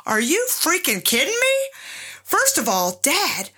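high, disbelieving inner outburst; child's silent outrage, voiced high; incredulous. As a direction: react in mock disbelief. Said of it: "I remember thinking".

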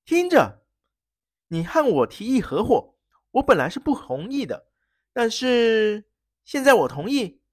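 threatening. Acted. Prince warning the baron in a threatening tone. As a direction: lean in with a threat. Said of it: "用威胁的口气说".

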